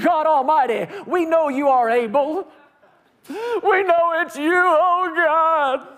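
high, loud begging cry; begging prayer. Retold or imitated. Preacher acting out begging God for help. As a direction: beg God with everything. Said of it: "and he began to beg".

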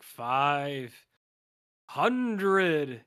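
very loud, drawn-out booming god voice. Retold or imitated. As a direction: proclaim the price like a deity. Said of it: "he has a booming god voice".

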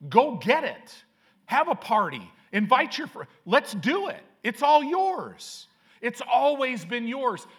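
rising to a loud, high, joyful invitation; joyful father. Retold or imitated. Father urging his older son to celebrate, loud and joyful.

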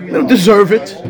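ordinary delivery

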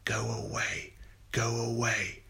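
a hushed, otherworldly voice fading up from a whisper; a haunting voice inside her head; a voice in her head, repeating go away; inner voice. A narrator's reading. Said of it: "A voice throbbed in her head".

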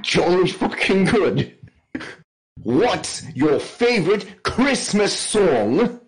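slow, exaggerated British accent; mock English accent. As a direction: stretch out a silly English accent with big swoops. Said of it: "his best English accent".

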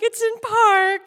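high, loud and drawn out; acted-out protest, stretched at the end; insistent yell. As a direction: call it back like a frantic teen. Teen insists to screaming mom.

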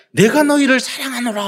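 a lightly voiced divine proclamation; God's declaration. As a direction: proclaim the love like God speaking. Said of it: "그렇게 말합니다".

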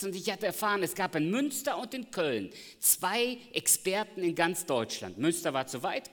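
loud, high preaching voice; fervent pulpit voice; full-force preaching. Acted. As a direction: preach it out to the congregation. Preaching surge again.

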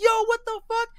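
very high and loud; a fan losing it at the twist; shocked yell. Retold or imitated. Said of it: "You're like".

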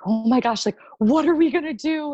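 high, loud, choked-up mock excitement; teary excitement. Wife imitating husband on phone, high teary excitement.

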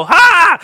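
loud, high triumphant ha; preacher pouncing with a loud ha; mock gotcha laugh. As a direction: bark a caught-you ha. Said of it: "And I'll go".

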